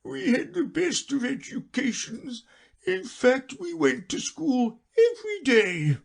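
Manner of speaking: high, mournful boasting; a sad creature proud of school; Mock Turtle.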